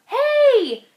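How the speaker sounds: high, loud bug voice calling up; a tiny bug calling out cheerfully; calling out as a little bug. Acted. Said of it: "The bug called out".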